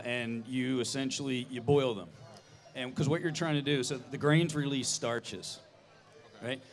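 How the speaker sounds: normal talking voice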